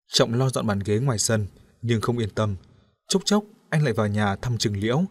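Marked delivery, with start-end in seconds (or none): none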